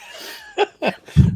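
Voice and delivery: high-pitched burst of laughter; laughing. Real, not acted. Reacting to seven movies tied for third, laughing. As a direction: crack up in a high giggle.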